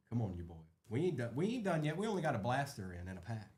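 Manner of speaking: ordinary delivery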